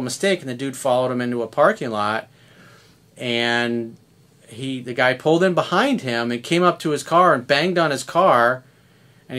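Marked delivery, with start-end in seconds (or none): none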